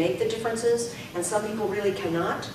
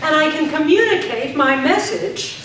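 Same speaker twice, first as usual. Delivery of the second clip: projected drill line; 5 semitones higher, 8 dB louder, slower than usual. Shown as a demonstration. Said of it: "repeat drill".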